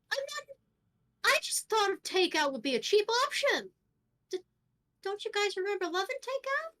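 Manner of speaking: high-pitched, wounded protest; pleading protest. Acted. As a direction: plead your case in a high, strained voice. Hippie protests to unconvinced friends.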